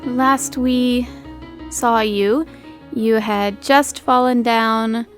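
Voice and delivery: ordinary delivery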